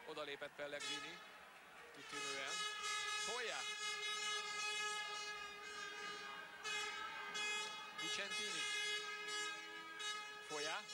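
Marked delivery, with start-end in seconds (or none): 1.9-10.8 excited hungarian sports commentary. Real, not acted. Live call of rapid attacking play.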